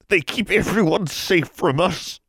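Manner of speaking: put-on old man forcing the words out; old man warns her, strained. A narrator's reading. Old man in transformation pain.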